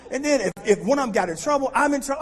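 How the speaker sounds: high, loud, near-crying wail; mock panic. Dorm chief panic retold.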